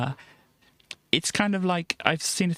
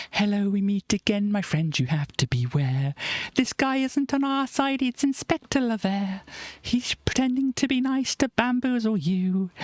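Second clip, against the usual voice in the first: put-on a lightly higher cheeky boy voice; voicing Gavroche; 6 semitones higher than usual. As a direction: tattle on the inspector like a kid. Puppet Gavroche voice in puppet show.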